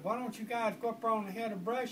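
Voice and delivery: high, loud calling woman; calling mother voice. Retold or imitated. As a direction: holler from the porch like a mother.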